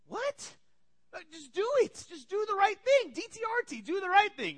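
high, loud pleading insistence; exasperated dad. Retold or imitated. Dad urging kids to do right, high exasperated plea. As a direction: imitate a dad at his wits' end.

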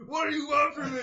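acted-out shout. Retelling the haunted fridge scene, high mock shout. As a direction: shout it like a cornered film character.

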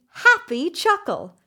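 ordinary delivery, nothing marked

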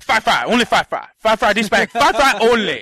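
loud, high imitation of a stubborn worker; mock insistent shouting. Drive-thru worker withholding fries from customer, loudly insistent. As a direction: hammer out each phrase loudly, mimicking him.

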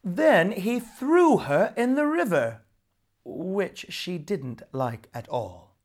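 ordinary delivery

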